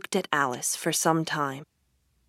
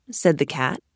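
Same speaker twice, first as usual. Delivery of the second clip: ordinary delivery, nothing marked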